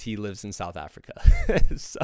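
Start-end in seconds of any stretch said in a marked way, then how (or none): 1.2-1.7 loud laugh. Real, not acted. Laughing at 4 AM recording.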